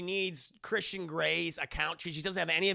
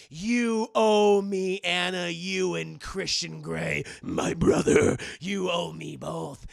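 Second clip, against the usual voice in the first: sinister villain; 8 dB louder, slower than usual. Acted. Villain threatening.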